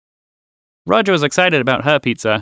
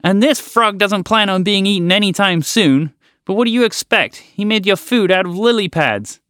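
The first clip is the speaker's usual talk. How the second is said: frog voice; 7 semitones higher than usual. Acted. Frog character speaks.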